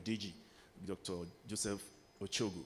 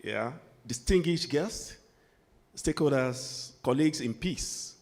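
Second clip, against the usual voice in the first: louder, ceremonial speech delivery; formal address; 4 semitones higher, 8 dB louder than usual. Acted. Speaker greets dignitaries at the conference, formal speech voice. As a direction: open the dialogue like a keynote at a podium.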